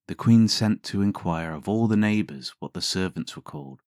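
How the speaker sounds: ordinary delivery, nothing marked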